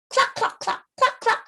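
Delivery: high, breathy clucks; hen clucking. Pretend. Teacher showing kids the hen, high clucking.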